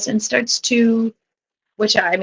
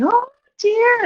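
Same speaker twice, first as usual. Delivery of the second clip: very high, drawn-out mock wail; imitated wailing grandmother. Bride imitates grandmother at the wedding, high mock wailing. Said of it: "then my grandmother going".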